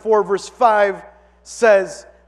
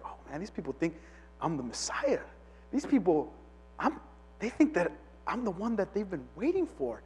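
hushed, much softer private musing; tempted inner thought; 2 semitones lower, 14 dB quieter than usual. Retold or imitated. John quietly musing about being taken for the Messiah, hushed. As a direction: say it under your breath, tempted by praise.

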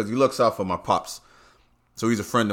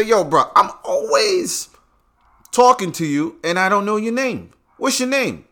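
high quoted self; 11 semitones higher, 5 dB louder than usual. Retold or imitated. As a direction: press him for his name, high and drawn. Asking the clerk's name.

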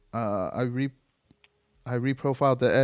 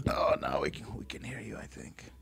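hushed unvoiced aside; whispering; 2 semitones lower, 6 dB quieter than usual. Real, not acted. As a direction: whisper it under your breath. Quiet aside while recording.

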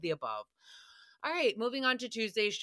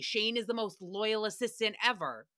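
ordinary talk, nothing marked

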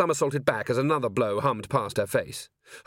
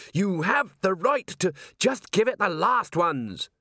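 high, slow, effortful speech; halting words under physical strain; 8 semitones higher, slower than usual. A narrator's reading. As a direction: force it out mid-struggle. Constable strains while grappling golem.

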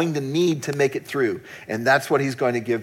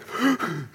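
sobbing gasp; 6 semitones higher than usual. Retold or imitated. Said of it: "I'm just heaving".

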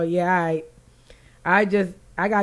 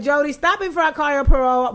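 movie quote; 7 semitones higher, 4 dB louder than usual. Retold or imitated. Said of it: "I want to be like".

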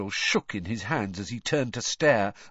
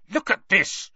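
higher, louder angry snarl; furious snarl; 10 semitones higher, 5 dB louder than usual. A narrator's reading. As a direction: thrust it at her in fury. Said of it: "Harry snarled".

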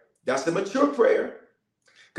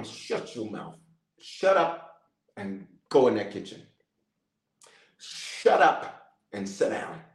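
low, restrained reprimand; stern Holy Ghost; 7 semitones lower than usual. Acted. Holy Ghost correcting someone about to talk crazy to a spouse, sternly. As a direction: drop the pitch and give a controlled scolding. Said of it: "the Holy Ghost is go".

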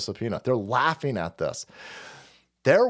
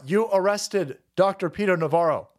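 normal talking voice

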